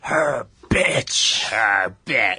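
exaggerated mock announcer voice; Vince McMahon. Joining in to mock the announcer, exaggerated commentator voice. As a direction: punch it out in a hammed-up imitation. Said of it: "he was doing it with Vince McMahon".

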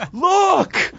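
very high, drawn-out mock holler; imitated yelling. Bruce yelling at the ref to look, high mock yell. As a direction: mimic an urgent yell to the referee. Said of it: "just yelling at the ref".

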